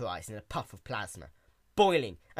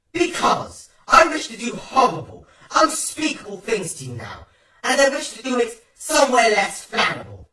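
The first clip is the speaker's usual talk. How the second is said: loud, higher, furious threat; raging threat; 10 semitones higher, 12 dB louder than usual. Acted. Twilight threatening Caramel, loud furious threat. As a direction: threaten him with boiling fury.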